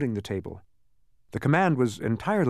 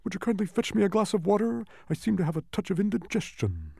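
high-pitched pleading bug; high, queasy gasping request; distressed gasp; 11 semitones higher than usual. A narrator's reading. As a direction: ask it in mild distress. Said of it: "turned to Milo and gasped".

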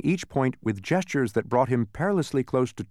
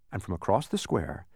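ordinary delivery

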